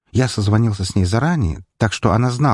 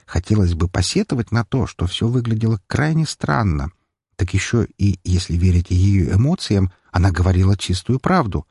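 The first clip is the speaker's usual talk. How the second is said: ordinary delivery, nothing marked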